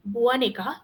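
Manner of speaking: ordinary delivery, nothing marked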